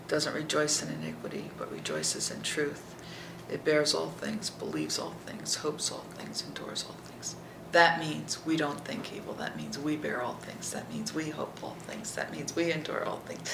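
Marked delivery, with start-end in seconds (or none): 1.6-7.3 softer and softer to a whisper; hushed fading whisper. Real, not acted. List fades to whisper. 9.3-13.5 soft hushed statement; hushed, earnest whisper. Real, not acted. Quiet application.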